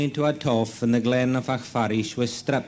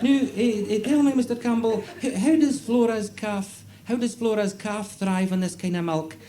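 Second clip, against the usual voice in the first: high-pitched, hesitant official, inquiring; comic high voice with halting starts; Department of Agriculture official; 9 semitones higher than usual. Acted. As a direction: stammer through an inspector's question. Official questions the farmer.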